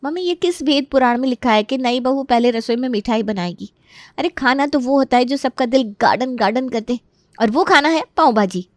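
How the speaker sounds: a hint of pleading enthusiasm; protesting son or daughter. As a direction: argue playfully with mother. Child protests to mother about food.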